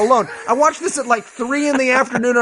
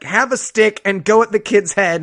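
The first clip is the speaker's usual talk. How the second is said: ordinary delivery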